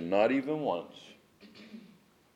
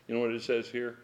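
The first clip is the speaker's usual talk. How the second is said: ordinary delivery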